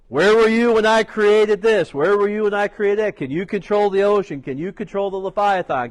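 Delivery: loud, booming challenge; God's voice. Acted. God answering Job from the whirlwind, booming. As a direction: thunder the questions down.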